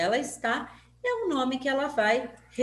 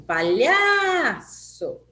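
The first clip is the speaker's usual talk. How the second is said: LHA in "palhaço" very long, high and loud; "palhaço" called out with a stretched LHA; stress on LHA; 5 semitones higher, 6 dB louder, much slower than usual. Shown as a demonstration. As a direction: slowly holler "palhaço", holding the middle syllable. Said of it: "Qual que eu falei mais?".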